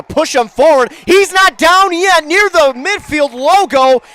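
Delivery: loud, high play call; excited call. Real, not acted. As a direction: ride the run all the way.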